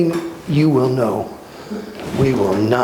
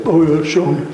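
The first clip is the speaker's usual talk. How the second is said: skeptic quote; 3 semitones higher, 3 dB louder than usual. Retold or imitated. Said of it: "Just weirdos that say".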